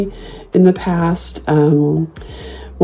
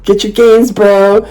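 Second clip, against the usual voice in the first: raised, mocking call; obnoxious taunt; 5 semitones higher, 4 dB louder, much slower than usual. Mocking the workout couple as they leave, obnoxiously. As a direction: jeer it after them like a mock send-off. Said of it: "It's just being obnoxious".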